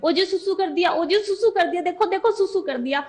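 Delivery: mock cry-out, pitched well up; retold tearful shouting. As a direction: re-enact calling out the news through happy tears. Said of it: "रोते-रोते चिल्ला-चिल्ला के कह रही हूँ".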